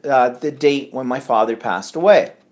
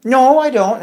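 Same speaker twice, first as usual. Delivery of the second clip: mother's denial; 10 semitones higher, 7 dB louder than usual. Retold or imitated.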